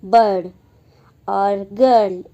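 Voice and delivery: बर्ड and गर्ल loud with a long er; er vowel demo. Shown as a demonstration. Teacher repeats the bird vowel for the class. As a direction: hold the er in गर्ल. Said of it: "इसको प्रयोग किया जाता है अ".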